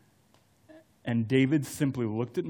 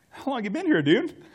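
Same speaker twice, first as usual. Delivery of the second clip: a much higher, lightly voiced friend calling out in surprise; surprised friend; 11 semitones higher, 3 dB louder than usual. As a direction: play the friend, caught off guard, pitch jumping up. Friend finds him in parking lot.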